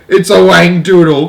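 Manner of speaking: loud, high, drawn-out yell. A narrator's reading. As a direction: yell the monster's name in alarm. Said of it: "yelled the head of the fire department".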